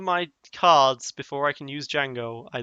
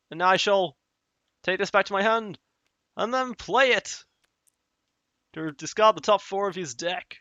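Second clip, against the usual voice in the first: mad scientist; 5 semitones higher than usual. Pretend. Villainous gloating narration of play.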